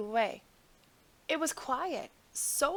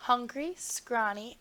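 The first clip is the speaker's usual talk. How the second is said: ordinary delivery, nothing marked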